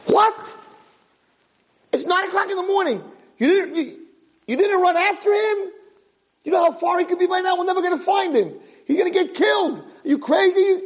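very high, frantic scolding; panicked outburst. Acted. The owner yelling at the guard who let the horse go. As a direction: shriek at him in disbelief.

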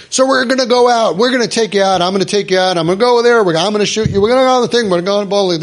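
loud, high, pushy dad imitation; overbearing father. Father booming plans at his intimidated kid, hammed-up. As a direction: play the big man steamrolling his kid. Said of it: "when he's nice, he's intimidating".

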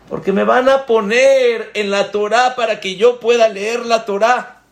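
a man sure he'll be honored, voice lifted high; eager hopeful inner voice. Acted. Hombre imagina que lo honrarán. (voz interior ilusionada, mucho más aguda)

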